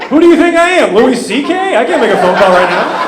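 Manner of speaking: high and loud outraged rant; indignant shout. Acted. Talking back to the porn-site lady, loud and outraged. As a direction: bellow it like she asked the impossible.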